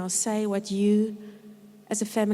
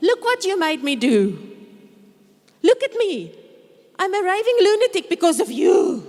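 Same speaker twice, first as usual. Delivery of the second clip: high, loud mock shouting at the kids; shouting blame at the children; raving lunatic; 7 semitones higher, 6 dB louder than usual. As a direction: rant like a raving parent blaming the kids. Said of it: "Blaming is the other thing".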